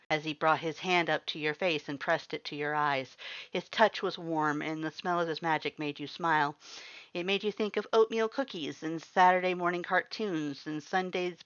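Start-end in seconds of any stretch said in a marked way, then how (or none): none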